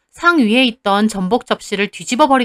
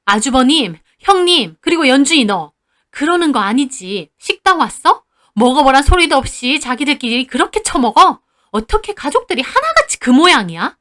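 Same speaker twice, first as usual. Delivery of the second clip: louder, higher rebuking rant; scolding in-laws; 3 semitones higher, 3 dB louder than usual. Acted. Mother scolds her greedy in-laws at the table, angry. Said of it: "가족에게도 따졌어요".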